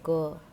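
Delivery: "क" said as Urdu क़, a little forced from the throat; throaty nukta push on "क"; nukta sound. Shown as a demonstration. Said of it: "थोड़ा सा उसको बल देकर बोला जाता है".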